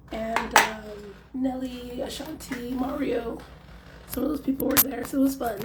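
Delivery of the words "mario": usual speaking voice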